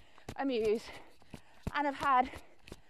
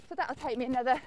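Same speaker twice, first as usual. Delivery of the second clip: ordinary talk, nothing marked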